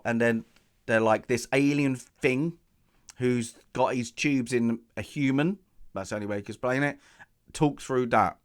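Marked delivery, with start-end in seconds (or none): none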